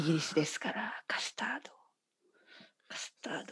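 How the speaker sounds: hushed, playful whisper. Real, not acted. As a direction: whisper it like a secret. Joking confession about custard.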